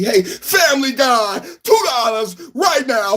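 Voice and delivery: shouting and half-singing a donor's name; hype shout-out. Acted. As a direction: belt it out like a hype man over the music. Super chat hype shout-out.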